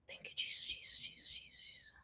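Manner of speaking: breathy whispered thanks, fading away; whispered prayer. Real, not acted. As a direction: whisper it to yourself like a private prayer. Quiet thanks to Jesus mid-stream.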